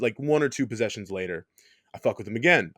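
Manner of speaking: ordinary talk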